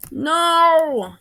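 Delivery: high, loud drawn-out cry; villain watching the grand plan fail; dramatic scream. A narrator's reading. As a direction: melodramatic scream of defeat. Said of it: "he screamed like a dramatic villain".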